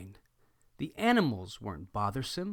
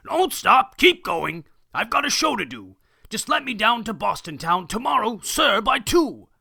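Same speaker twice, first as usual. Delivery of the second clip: high, loud furious yell; screaming; 7 semitones higher, 8 dB louder than usual. Acted. Circus boss screaming at the captain to keep going. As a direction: bellow it at the captain.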